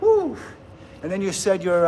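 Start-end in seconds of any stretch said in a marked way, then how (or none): 0.0-0.4 high loud mock whoop; imitating a cheerful island whoop; Hawaiian woo. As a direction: belt out a playful woo like a Hawaiian friend. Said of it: "every Hawaiian I know was kinda like".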